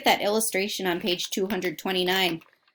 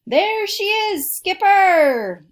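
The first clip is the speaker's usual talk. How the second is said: much higher and louder, drawn out, hailing a boat across the water; calling out; 12 semitones higher, 10 dB louder, much slower than usual. Acted. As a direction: shout it across the waves, slowly. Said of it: "Shack called across".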